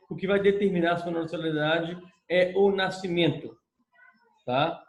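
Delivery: ordinary delivery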